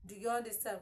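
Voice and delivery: normal talking voice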